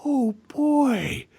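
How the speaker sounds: high-pitched mock amazement; hammed-up childlike awe; over-wondrous amnesiac. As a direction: parody a gushing, unfitting game voice. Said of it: "he's going around like".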